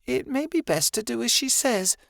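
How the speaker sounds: high, lightly voiced gentle Martin; mumbling Martin. A narrator's reading. Martin warning Angel to step back, mumbled. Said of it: "Martin mumbled".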